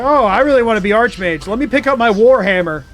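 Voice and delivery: mock enthusiasm; eager player. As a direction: play an eager would-be mage, tongue in cheek. Voices a would-be Archmage player.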